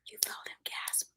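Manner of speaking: usual speaking voice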